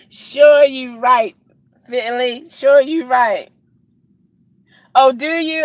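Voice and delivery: slow, loud sassy drawl. Real, not acted. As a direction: draw it out with attitude. Teasing banter with chat.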